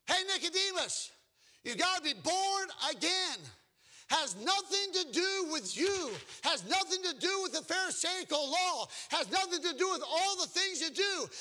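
high, loud, emphatic preaching; full-force preaching. Acted. Preacher challenges churches that are missing God, full-force preaching. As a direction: cry it out to the whole congregation.

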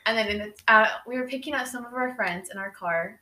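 ordinary talk

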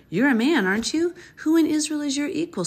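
high, loud mocking call; taunting shout. A narrator's reading. David taunting Abner from afar, high loud mocking shout. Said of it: "David called to Abner".

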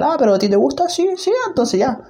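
high-pitched eager inner voice; happy self-acceptance. Acted. Coaching himself about his crazy ideas, happily accepting. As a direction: act out cheerfully agreeing with yourself, pitch jumping up.

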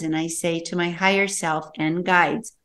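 normal talking voice

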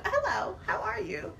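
high, soft, mock-demure voice; coy sweet greeting. Smitten woman greeting a New York brother, coy and high.

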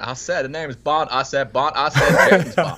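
laughing Foghorn Leghorn-style Southern drawl; mock Southern accent. Guest joins in as a Southern Bond, mock Southern accent. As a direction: repeat it in a hammed-up Southern bluster, breaking into laughter. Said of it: "in a Southern accent".

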